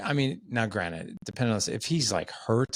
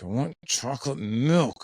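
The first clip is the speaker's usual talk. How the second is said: put-on slow, droopy kid's whine; sulky seven-year-old asking for milk; mopey Eeyore voice; 5 semitones higher than usual. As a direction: whine it like a gloomy donkey. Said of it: "He had the Eeyore going".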